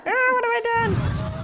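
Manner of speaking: mock panic. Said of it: "Be like".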